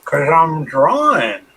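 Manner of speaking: talking around a pen in the mouth; pen in teeth. Real, not acted. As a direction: say it with a pen between your teeth. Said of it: "Are you drawing or eating?".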